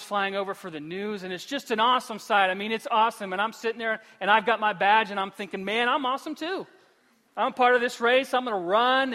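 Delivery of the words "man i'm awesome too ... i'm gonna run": pumped-up self-talk, a bit higher and louder; excited inner voice. Retold or imitated. Runner at the start line telling himself he's awesome, excited. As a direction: psych yourself up before the race. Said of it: "and I'm so excited".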